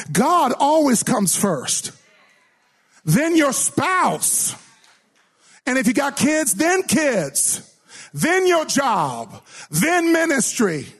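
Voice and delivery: high, slow, each item spaced; emphatic ordering. Acted. Preacher ranking priorities for the congregation, slow and high. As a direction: lay out each priority slowly.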